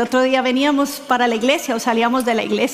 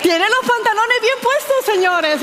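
delighted proud echo; 8 semitones higher than usual. Retold or imitated. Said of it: "Entonces yo digo".

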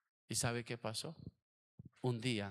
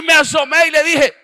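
fiery preacher building to a roaring climax, higher and louder; preaching at full force; 4 semitones higher, 26 dB louder than usual. Acted. Pastor preaching his testimony to the congregation, rising to a fiery shout.

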